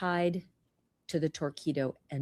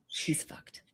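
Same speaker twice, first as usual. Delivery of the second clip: whispered, hushed verdict; whispered aside; 8 dB quieter than usual. Real, not acted. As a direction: drop to a whisper. Aside about suspect.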